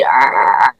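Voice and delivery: low froggy growl imitating his speech; Kermit-like voice. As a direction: attempt a deep Kermit-style noise. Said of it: "I can't even do that voice".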